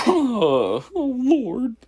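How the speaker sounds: high pleading whine broken by laughter; mock the creep and crack up; mocking whimper while laughing. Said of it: "time for the oh lord".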